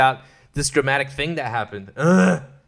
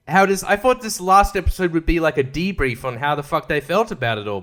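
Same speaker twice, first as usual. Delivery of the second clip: ordinary delivery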